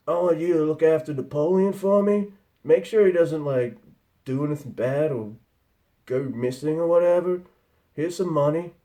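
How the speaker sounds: Ted. Acted. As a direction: act out a stoner asking his brother a favour. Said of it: "Ted goes to his brother".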